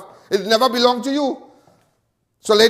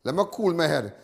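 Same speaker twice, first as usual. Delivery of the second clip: much softer and lower, pulling himself back; calming down; 9 semitones lower, 11 dB quieter than usual. Real, not acted. Upset speaker reining himself in on stream, soft and low. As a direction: hush yourself to cool off after getting emotional. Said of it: "I try starting to get emotional".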